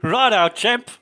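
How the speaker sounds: loud, high brash Aussie bloke; aussie tradesman. Acted. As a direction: shout it like a cheeky Aussie tradie. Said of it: "You just say".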